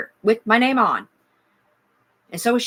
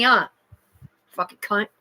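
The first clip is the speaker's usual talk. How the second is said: ordinary delivery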